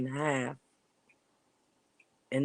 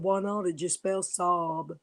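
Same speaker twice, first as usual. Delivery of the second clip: a lightly voiced innocent little girl; 5 semitones higher than usual. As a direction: play the clueless kid who doesn't get it. Quoting her child sister.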